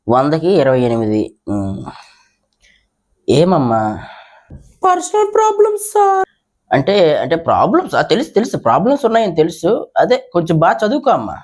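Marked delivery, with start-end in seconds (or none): 4.8-6.3 put-on high coy girlish excuse; high schoolgirl voice. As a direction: mumble an excuse like a shy girl. Schoolgirl excuses low marks.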